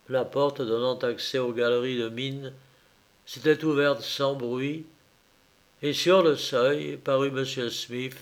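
ordinary delivery, nothing marked

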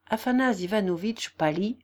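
usual speaking voice

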